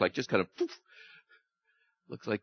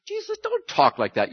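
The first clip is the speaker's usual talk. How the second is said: much higher, mock reproach; scolding Peter. Peter pulls Jesus aside to protest, mock scolding. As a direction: scold him like a worried friend.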